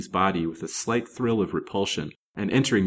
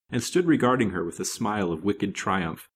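plain reading voice

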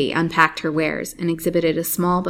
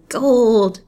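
higher and louder greedy exclamation; the hag's greed bursts out loud; greedy cry; 5 semitones higher, 8 dB louder than usual. A narrator's reading. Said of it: "she muttered".

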